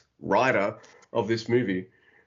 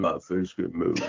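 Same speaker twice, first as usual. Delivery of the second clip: Sylvester Stallone; 7 semitones lower than usual. Acted. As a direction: mumble it as if the face is frozen. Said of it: "Can you enunciate more?".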